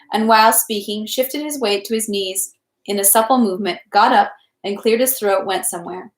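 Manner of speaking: ordinary delivery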